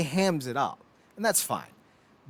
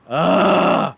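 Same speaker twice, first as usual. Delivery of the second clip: disgusted ugh; 4 semitones higher, 9 dB louder than usual. Real, not acted. Disgust at Fran Drescher's voice.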